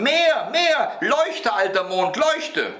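put-on high, insistent little boy's yell; shrieked command. A narrator's reading. Boy orders the old moon to shine, high shriek. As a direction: squeal orders like a greedy child. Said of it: "schrie Häwelmann".

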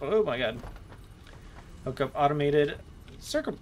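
ordinary talk

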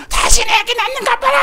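very high, loud howl; acted-out labour fury; raging scream. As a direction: shriek it out, imitating the next-bed mother. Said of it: "욕하고 난리를 쳐요".

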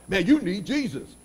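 imitating a joker, pitched a little up; facetious jibe. People joking with each other all the time, facetious. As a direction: toss it out as a mock-serious tease. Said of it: "they say it facetiously".

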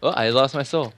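ordinary talk, nothing marked

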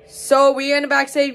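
usual speaking voice

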